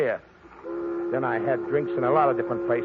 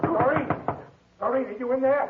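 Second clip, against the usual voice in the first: calling out; 12 semitones higher than usual. Acted. Man pounding at apartment door.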